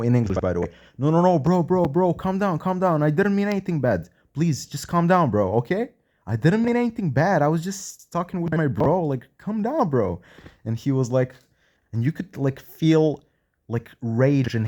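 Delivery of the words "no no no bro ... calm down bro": high, urgent pleading; placating plea. Retold or imitated. Man retelling a frightened plea to a tense patient, high and placating. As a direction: talk someone down in a raised, insistent voice. Retold attempt to calm someone down.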